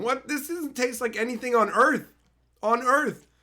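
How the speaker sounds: high, loud outraged rant; exaggerated disgust. Real, not acted. Mocking a viewer's snack, high and loud outrage.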